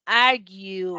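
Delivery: "argue" with a long, loud aaar; lengthened vowel. Shown as a demonstration. Teacher showing the two-dot symbol, "argue" with its first vowel drawn out. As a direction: stretch "argue" out very slowly. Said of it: "hay que alargarla".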